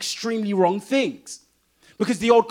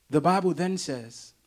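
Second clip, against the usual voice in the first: ordinary talk, nothing marked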